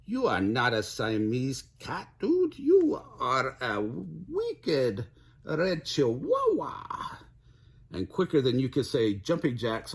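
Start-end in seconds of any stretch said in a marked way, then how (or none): 0.0-7.2 slow, hammed-up Spanish accent. A narrator's reading. Kitty boy addressing his reflection, hammed-up Spanish accent. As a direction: play it up in a high, drawn-out Spanish accent. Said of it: "using his very best Spanish accent".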